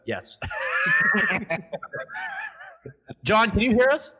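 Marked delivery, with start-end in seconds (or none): 0.4-3.2 shrill burst of laughter; high shrieking laugh. Real, not acted. Cracking up at John's broken audio, high shrieking laugh. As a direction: cackle way up high. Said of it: "laughter at John's voice".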